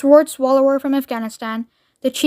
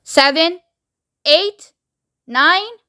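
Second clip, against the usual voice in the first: raised, spaced-out counting; countdown voice; 5 semitones higher, much slower than usual. Acted. Loudspeaker voice counting down, measured announcement. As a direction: count off each number in a high announcing voice. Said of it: "said the voice".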